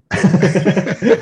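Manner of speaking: a high, bright burst of laughter; real laugh. Real, not acted. Guest laughing after cheering for Malaysia, real high laugh.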